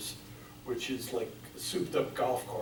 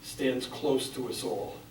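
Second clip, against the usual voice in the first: measured, solemn poetry recital; grave spoken verse, firmly projected; poem reading; 4 dB louder than usual. Acted. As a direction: read it out in deliberate phrases, pausing after each. Reads own poem aloud.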